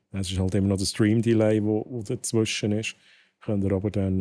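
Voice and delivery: normal talking voice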